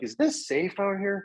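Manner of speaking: retold high-pitched worried question; mock-worried, high and drawn out. Retold worried question on remote shoot.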